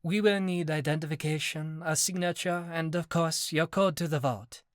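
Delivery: put-on a bank teller speaking English in a thick French accent. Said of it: "replied in a thick accent".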